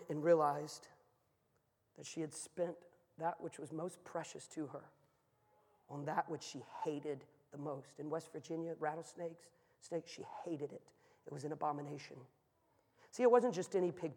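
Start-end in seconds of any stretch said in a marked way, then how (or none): 2.0-12.2 soft, sober storytelling; quiet, weighty delivery with pauses; grave and hushed. Real, not acted. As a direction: lower the volume and let the moral sink in. Grave moral of the snake story.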